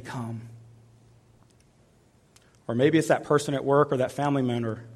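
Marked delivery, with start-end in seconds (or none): 0.0-0.5 soft, low come; hushed low call. Acted. Jesus quietly calling through fear. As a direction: whisper the invitation.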